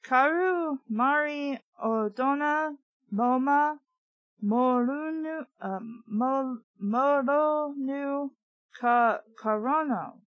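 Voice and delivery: slow, careful sounding out of Karu Mari Odona Moma; reading strange words. Acted. Trina reading the foreign incantation aloud, slowly. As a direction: stumble slowly through Moronu and Karono. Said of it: "I read the words out loud".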